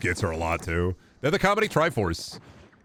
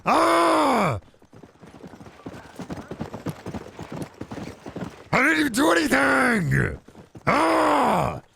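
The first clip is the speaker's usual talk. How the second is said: through gritted teeth; 12 semitones higher, much slower than usual. Retold or imitated. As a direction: scream with teeth clenched shut. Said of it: "he does this thing where he yells through his teeth".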